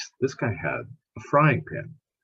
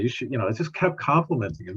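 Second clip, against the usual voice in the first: normal talking voice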